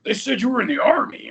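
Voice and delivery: high-pitched mock-puzzled challenge; incredulous director. Director questioning a young actor about the Army, incredulous. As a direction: imitate a director taken aback, pitch swooping up.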